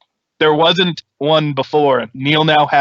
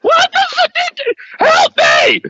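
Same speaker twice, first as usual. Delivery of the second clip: screaming; slower than usual. Acted. Shot character screams for help.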